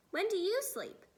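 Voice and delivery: put-on a little girl's curious question; lightly voiced girl; Sophie asking. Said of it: "Sophie asked".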